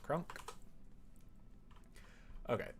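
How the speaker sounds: ordinary talk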